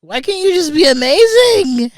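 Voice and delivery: mock crying over the letdown; mock wail. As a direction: wail it like a kid about to cry. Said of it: "I'm gonna cry".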